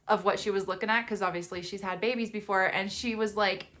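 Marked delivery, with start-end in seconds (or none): none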